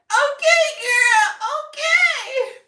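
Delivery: very high, loud, comic screech; hammed-up yell of surrender; exasperated shriek. Woman giving in to pushy people, shrieking.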